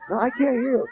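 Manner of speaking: a hint of a heckler; audience complaint. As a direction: listener calling out. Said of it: "you go".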